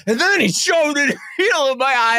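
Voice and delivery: fast, high mock retelling; mimicking his storytelling. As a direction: parody his frantic story, laughing through it. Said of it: "telling me the story like".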